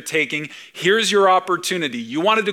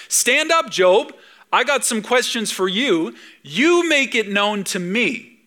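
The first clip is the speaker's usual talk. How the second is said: God's mock-sarcastic dare; voicing God; 5 semitones higher than usual. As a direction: call Job out, slowing at the end. God challenging Job, voiced.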